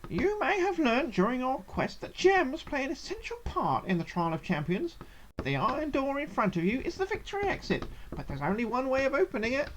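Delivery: high, lilting gnome voice; cheerful gnome. A narrator's reading. Egbert the Gnome explaining the final test, cheerful high voice. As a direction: perform a cheery little trial master, high-pitched. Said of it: "he says cheerfully".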